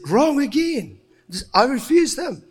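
acted high-pitched refusal; emphatic rejection. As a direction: reject it firmly, pitched well up. God rejecting Samuel's choice, acted.

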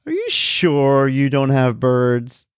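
slow, drawn-out doubtful question; doubtful support guy. Retold or imitated. Verizon guy on the phone asks again, slow and unsure. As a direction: spell out each word, puzzled and suspicious. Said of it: "the Verizon guy goes".

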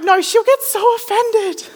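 very high, squeaky objection; mock panicked protest. Speaker arguing with the voice in her head, mock panicked. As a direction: pitch it way up, pleading against the idea.

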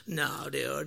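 low, very soft brush-off; apathetic refusal. Retold or imitated. Local friends turning down the eclipse trip, apathetic. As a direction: imitate indifferent friends, dropping pitch and volume.

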